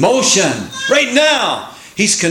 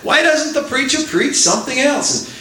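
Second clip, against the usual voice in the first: much higher, whiny churchgoer grumble; mock complaint; 12 semitones higher than usual. Preacher imitating a churchgoer grumbling about sermons, whiny mockery. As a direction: sneer it like a disgruntled parishioner. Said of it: "a lot of people will say".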